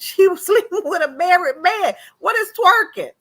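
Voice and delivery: high, loud, incredulous outburst; indignant exclamation. Real, not acted. Vlogger defends the wife against critics, loud indignant protest. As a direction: pitch it way up in animated protest.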